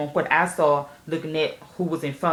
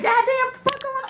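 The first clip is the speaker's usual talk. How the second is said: shocked. Retold or imitated. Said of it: "And I'm like".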